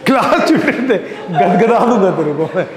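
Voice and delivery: playful threat through laughter; laughing mock threat. Real, not acted. Teacher laughingly threatens the timid reader. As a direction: tease the student while cracking up.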